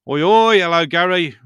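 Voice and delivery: loud, high cheery hello; big pub-style welcome; excited greeting. Real, not acted. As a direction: call out to a friend arriving. Greeting a viewer on stream.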